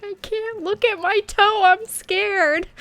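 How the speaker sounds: high, loud mock-tearful wail; crying, scared. Freaked-out daughter to mom about cut toe, imitated crying. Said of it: "I just start crying".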